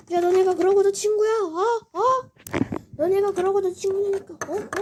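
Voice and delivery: high, loud indignant yell; angry accusation. Acted. Betrayed friend yelling at the others, high angry accusation. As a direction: shout at them for betraying you.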